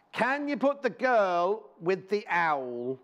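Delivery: slow, drawn-out mock posh read. Presenter reading a viewer's request aloud, mock posh. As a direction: read the viewer's note like a lady. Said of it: "that's very posh".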